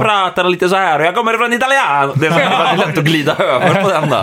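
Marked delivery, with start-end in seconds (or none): 0.0-2.1 high, exaggerated Finland-Swedish lilt sliding into Italian; pitched-up Finnish accent turning mock Italian. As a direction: imitate a Finn, drifting into an Italian accent. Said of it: "om jag ska försöka härma en finne".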